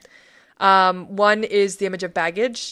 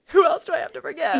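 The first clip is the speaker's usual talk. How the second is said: high, exasperated retold dread; hammed-up, high-pitched complaint; mock dread; 8 semitones higher, 3 dB louder than usual. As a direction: play up the dread, half laughing. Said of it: "I started dreading it".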